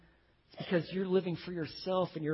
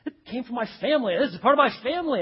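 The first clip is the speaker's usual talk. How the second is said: higher, fast protest; retold indignant refusal; 7 semitones higher, 5 dB louder, faster than usual. Neighbor defending his family vineyard to Ahab, acted indignant refusal. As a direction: protest quickly as the offended neighbor.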